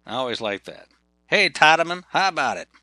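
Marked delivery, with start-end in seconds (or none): 1.3-2.7 louder, higher Georgia hello; Southern greeting. Retold or imitated. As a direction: call it out like a good old boy. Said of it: "they tended to say".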